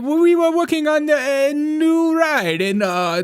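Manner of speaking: put-on high, pleading Arabic-accented prince. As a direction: whine it like a Gulf prince. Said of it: "It's the prince".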